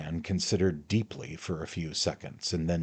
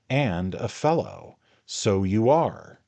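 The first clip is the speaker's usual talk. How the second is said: slow, louder, deliberate; slow angry charge; 5 semitones higher, 9 dB louder, slower than usual. A narrator's reading. Pickwick repeats charges to Tupman, slow and loud. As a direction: lay each charge down slowly. Quarrel continues.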